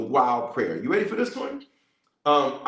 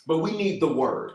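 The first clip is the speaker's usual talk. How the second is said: normal talking voice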